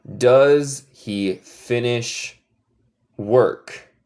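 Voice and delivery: "Does he finish" drawn out slowly; slow model question. Shown as a demonstration. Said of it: "Como si".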